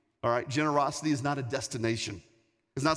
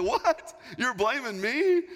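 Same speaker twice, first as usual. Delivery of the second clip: very high, drawn-out incredulous questions; mock indignant disbelief; 9 semitones higher, 3 dB louder, slower than usual. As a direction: squeal it in exaggerated astonishment. Retold shock at being blamed.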